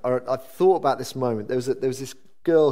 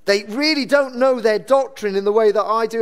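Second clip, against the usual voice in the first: higher, louder mock boasting; self-righteous; 10 semitones higher, 5 dB louder than usual. Churchgoer comparing doctrine with younger believers, self-righteous. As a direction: act out a smug believer, pitched up and emphatic.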